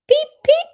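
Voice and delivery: very high, loud peeping; chick peep. Acted. Teacher voicing chicks for the class, high peeps. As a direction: squeak it like a tiny chick.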